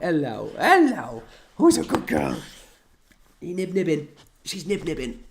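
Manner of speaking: slow, cooing pet talk; talking to the dog. Real, not acted. Host cooing at the dog while wrestling her, pet talk.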